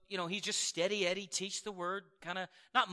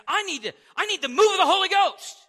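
high, loud demand for the move of the Holy Ghost; mock demanding shout; 9 semitones higher, 12 dB louder than usual. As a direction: shout it like the impatient churchgoer. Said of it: "He's like".

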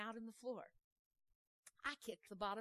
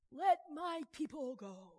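slow, loud, higher proclamation; commanding Moses; 4 semitones higher, 7 dB louder, slower than usual. Acted. Moses to Pharaoh, slow loud command. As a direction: boom it out like a prophet.

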